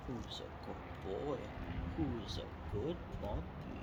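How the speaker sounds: soft, slow puppy talk. Real, not acted. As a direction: coo to the puppy. Baby talk to dog.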